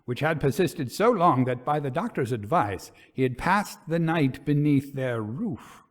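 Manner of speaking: dramatic audiobook narration, building dread toward the end; story narration. A narrator's reading. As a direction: read it like a ghost story to listeners. Reads the ghost story aloud.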